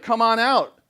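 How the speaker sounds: mock challenge to the gods; taunting. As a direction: call them out, goading. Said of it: "he's like".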